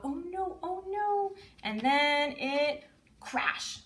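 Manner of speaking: high, very slow dramatic crash build-up; mock alarm. Shown as a demonstration. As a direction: stretch out the crash for a toddler. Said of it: "we like to shake the book".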